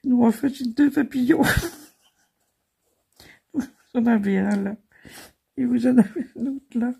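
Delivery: high and giggly; talking through laughter; laughing. Real, not acted. Fou rire continue. (en riant, voix aiguë)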